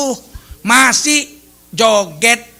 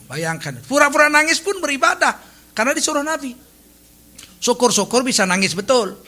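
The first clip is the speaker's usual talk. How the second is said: normal talking voice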